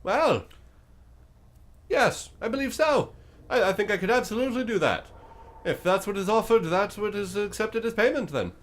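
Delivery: high, loud, oddly cheerful creature; a chipper monster eager to bargain. Acted. GM's bone creature answers the party, high and chirpy.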